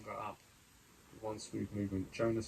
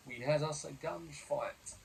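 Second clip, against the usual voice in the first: slow gruff sailor voice; pirate voice; 5 semitones higher, slower than usual. A narrator's reading. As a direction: drag it out like an old sailor.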